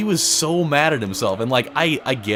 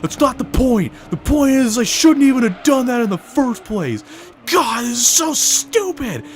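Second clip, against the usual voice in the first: high, louder put-on fury; mock the guy seething at himself; angry rant; 10 semitones higher, 4 dB louder than usual. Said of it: "He's just like".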